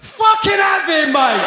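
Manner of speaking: slow, high shouted cheer. Retold or imitated. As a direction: bellow it like a lad on the terraces.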